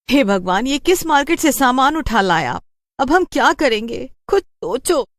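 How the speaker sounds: high, exasperated lament; despairing wife. Acted. Wife lamenting to her husband about the cursed goods, high and exasperated. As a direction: scold him in despair.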